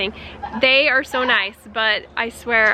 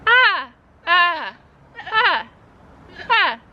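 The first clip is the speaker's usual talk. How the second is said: higher, short bleating calls; quiet goat bleat; 5 semitones higher, much slower than usual. Shown as a demonstration. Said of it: "Watch, I'll do it".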